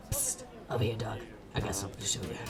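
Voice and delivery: low hushed "psst" come-here; conspiratorial half-whisper, pitched low; secretive beckoning. Acted. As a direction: whisper it like a shady alley tip-off. Cat secretly beckons the player.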